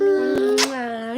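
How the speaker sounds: loud high kiss sound; smacking kiss. Acted. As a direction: plant a big smooch.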